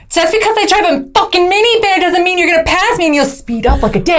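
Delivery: high, indignant road-rage rant; mock rant at someone trying to pass; aggressive driver. Wife imitating her highway temper, indignant rant. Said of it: "you'll be like".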